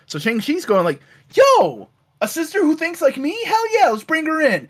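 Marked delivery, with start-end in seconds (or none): 1.3-4.7 high-pitched, eager retold reaction; excited acted quote. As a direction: play the brother thrilled to find an ally. Retelling character's delighted reaction.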